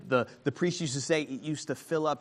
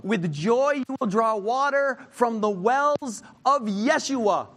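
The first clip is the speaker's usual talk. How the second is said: much higher and louder, slow and exultant; preaching at full force; 7 semitones higher, 4 dB louder, slower than usual. Acted. Preacher reading Isaiah's song to congregation, exultant preaching. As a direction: proclaim it like a shout of joy.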